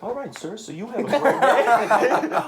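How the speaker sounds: high mock-polite goodbye, laughing; laughing through it. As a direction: say goodbye while cracking up. Driver drops passenger, laughing.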